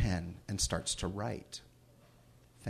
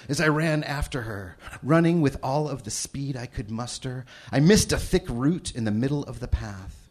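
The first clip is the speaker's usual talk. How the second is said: reading a novel passage with rising tension; dramatic story reading; 3 semitones higher, 6 dB louder than usual. Acted. Father reads his daughter's novel to the audience, building dramatic tension. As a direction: narrate the chase like it's happening now.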